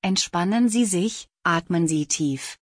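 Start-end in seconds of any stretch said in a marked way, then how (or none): none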